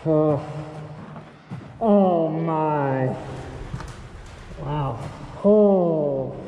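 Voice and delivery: muffled behind a dust mask; talking through a face mask. Real, not acted. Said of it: "Sorry for the muffled voice".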